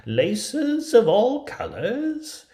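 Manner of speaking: high, loud peddler voice; peddler hawking. Acted. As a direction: sell it like a crafty old hawker. Peddler offers laces.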